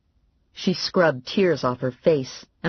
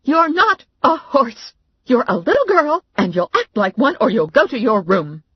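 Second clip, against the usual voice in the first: angry yell; 10 semitones higher, 7 dB louder than usual. A narrator's reading. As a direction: lay down the law to the child. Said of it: "my mother yelled".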